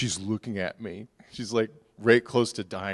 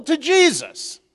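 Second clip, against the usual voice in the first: higher and louder, driving the story home; fiery preaching; 11 semitones higher, 12 dB louder than usual. Acted. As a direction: preach it at full force.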